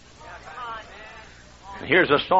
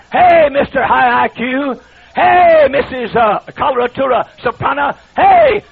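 loud, higher, slow hammering calls; fiery pulpit shouting, drawn-out and emphatic; preaching at full force; 8 semitones higher, 8 dB louder, slower than usual. Acted. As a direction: thunder it at the congregation like a revival preacher. Preacher thundering at congregation.

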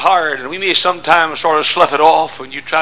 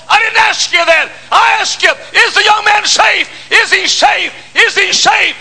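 king shouting his question; demanding shout. Retold or imitated. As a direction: press him hard for an answer.

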